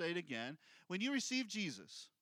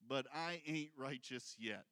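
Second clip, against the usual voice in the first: low, soft put-on doubter; a low, hushed sigh of doubt; self-doubting believer; 7 semitones lower, 6 dB quieter than usual. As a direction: mutter it like a discouraged churchgoer. Congregation's self-doubt voiced.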